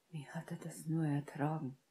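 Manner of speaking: hushed, appalled whisper; soft breath-only voice. A narrator's reading. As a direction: whisper the question softly. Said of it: "flüsterte Harry".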